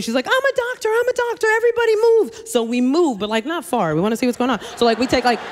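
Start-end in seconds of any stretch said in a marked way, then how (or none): 0.3-2.4 very high mock scream; retold screaming. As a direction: imitate a frantic doctor clearing the crowd. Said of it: "She starts screaming".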